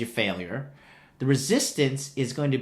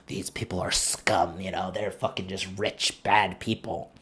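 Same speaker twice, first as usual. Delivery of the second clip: mock scowling sneer; 7 semitones lower, 8 dB quieter than usual. Mocking Rose who sneers at the rich guests, low scornful grumble.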